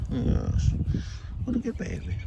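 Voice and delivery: dote on the cat in a high sing-song. Real, not acted. Cooing at the cat.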